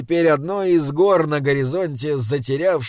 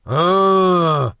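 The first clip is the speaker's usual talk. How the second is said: dim giant. Acted. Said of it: "пробасил великан".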